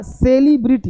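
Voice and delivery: "सेलिब्रिटी" as SAY-lee-bri-tee; long, leaning say-lee at the start of "सेलिब्रिटी"; wrong pronunciation. Shown as a demonstration. Teacher points out the mistake, wrong pronunciation. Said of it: "ये गलत है".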